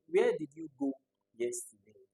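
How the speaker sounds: "where did you go yesterday" high at first, falling to the end; falling intonation. Shown as a demonstration. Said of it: "a falling intonation".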